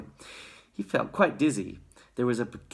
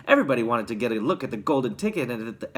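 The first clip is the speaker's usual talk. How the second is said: usual speaking voice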